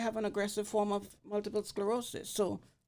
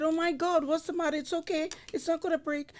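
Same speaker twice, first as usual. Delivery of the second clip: alarmed reassurance; 9 semitones higher than usual. Retold or imitated. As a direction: retell her alarm, pitched up. Comforting crying fan, retold.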